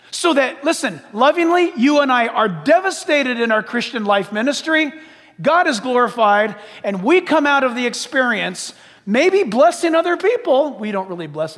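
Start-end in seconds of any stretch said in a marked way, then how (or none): none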